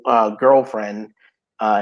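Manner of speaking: ordinary talk, nothing marked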